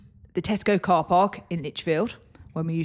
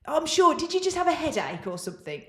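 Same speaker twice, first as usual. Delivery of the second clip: mock caller; 7 semitones higher than usual. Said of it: "And they were like".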